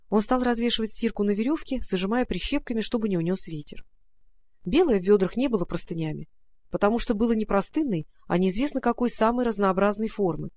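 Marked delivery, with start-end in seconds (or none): none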